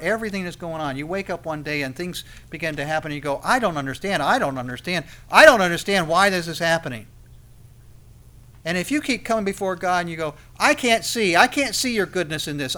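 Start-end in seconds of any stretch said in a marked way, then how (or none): 3.4-7.1 frustrated complaint. Acted. Acts out a bewildered believer. 10.6-12.8 loud, raised mock protest; frustrated complaint. Believer coming before God unable to see, frustrated and loud.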